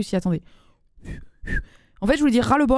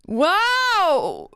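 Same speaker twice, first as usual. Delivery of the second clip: long high swooping wow; amazed wow; 7 semitones higher than usual. Real, not acted. Host reacting to an outrageous joke, amazed wow. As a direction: draw out an astonished wow.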